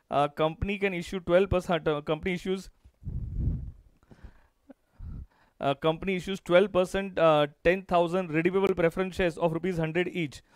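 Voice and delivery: very slow, word by word; slow classroom dictation. Shown as a demonstration. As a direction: spell it out for students to copy. Dictating the question slowly.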